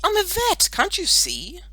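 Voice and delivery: high, loud indignant retort; snapped. A narrator's reading. Girl tells boy she is a vet, snapping. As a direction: protest crossly at the silly mistake. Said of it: "Melanie snapped".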